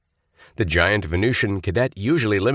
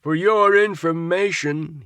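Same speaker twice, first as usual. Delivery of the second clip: slow, higher bawl; 11 semitones higher than usual. A narrator's reading. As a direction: bawl it pompously. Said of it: "he bawled".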